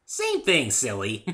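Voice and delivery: high, loud giggly retort; giggling Pinky. A narrator's reading. Pinky giggles at the narrator's correction, high and loud. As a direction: laugh it off playfully.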